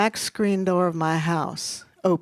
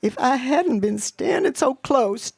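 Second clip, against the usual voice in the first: high, louder, agitated outpouring; distraught confession; 7 semitones higher, 3 dB louder than usual. A narrator's reading. Mama blames herself for Arthur's death, distraught. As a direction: let the guilt spill out, pitched high. Mama's tearful guilty confession.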